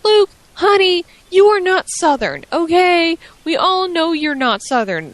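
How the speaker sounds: high, loud mock scolding of a wrestler; condescending, high-pitched telling-off; exasperated scolding. Mock-scolding Luke Harper on air.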